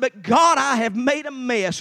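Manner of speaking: loud, high cry to God; retold anguished prayer. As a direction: confess it loudly, slow and emphatic. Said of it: "I said".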